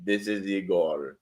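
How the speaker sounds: put-on low, slow Russian accent. Retrying the Russian caller Igor, low Russian accent.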